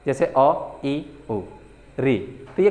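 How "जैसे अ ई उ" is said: अ, ई, उ said short and clipped as short vowels; teacher showing short vowel sounds; short vowel demo. Shown as a demonstration. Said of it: "बोलने में बहुत कम समय लगता है".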